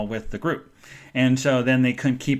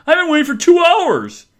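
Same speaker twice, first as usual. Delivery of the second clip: high, loud mock outrage; upset; 9 dB louder than usual. Colleague stuck waiting for a room, loudly upset. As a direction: complain loudly, imitating an upset man.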